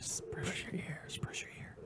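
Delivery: soft mock ASMR whisper. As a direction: whisper close into the ear. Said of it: "A S M R podcast".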